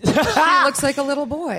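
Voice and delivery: excited. Real, not acted. Punchline joke.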